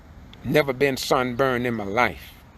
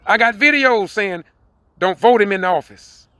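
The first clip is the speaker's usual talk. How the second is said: louder, higher, rising to a fiery sermon shout; full-force preaching; 5 semitones higher, 6 dB louder than usual. Acted. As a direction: preach it hard to the congregation.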